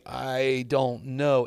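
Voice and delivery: slow, drawn-out unsure answer; hesitant. Retold or imitated. Golfer answering his intense mentor, hesitant. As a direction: stretch each word, acting out uncertainty.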